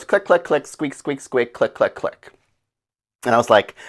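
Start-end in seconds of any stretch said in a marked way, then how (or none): 0.0-2.3 softer, mock dolphin clicks and squeaks; lightly imitated dolphin chatter. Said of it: "this dolphin goes".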